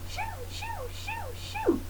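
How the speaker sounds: very high, soft shoo shoo. Shown as a demonstration. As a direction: shoo it away in a tiny high voice. Shooing the lion away.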